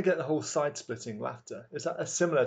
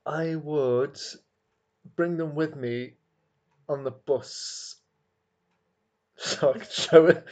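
ordinary delivery

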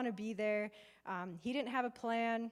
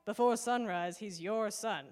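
louder, lightly acted groggy lion dad; Mufasa; 6 dB louder, slower than usual. Mufasa passing Simba to his mate at dawn, lightly imitated. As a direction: put on a hint of a woken-up father. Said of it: "Mufasa to Nairobi's like".

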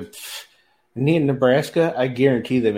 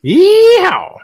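yeehaw; 16 dB louder than usual. Real, not acted. Cowboy yell at show's end.

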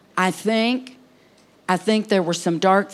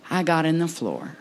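normal talking voice

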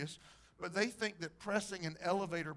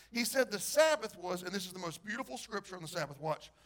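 ordinary talk

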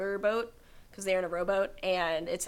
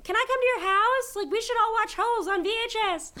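much higher, gushing and fast; imagined excited kids; 12 semitones higher, 3 dB louder than usual. Retold or imitated. Girl imagining classmates thrilled about her VHS. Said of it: "people being like".

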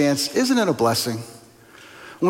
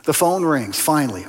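ordinary talk

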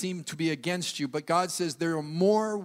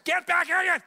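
much higher, loud shout at a runaway dog; yelling at the dog; 12 semitones higher, 5 dB louder than usual. Shown as a demonstration. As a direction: yell it like your dog's bolting into the street. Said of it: "Your dog's running across the street, that voice".

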